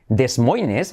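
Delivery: DES MOINES read Spanish-style, "des MOY-nes", both S sounds said; Spanish spelling reading. Shown as a demonstration. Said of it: "tienes que parar de decir".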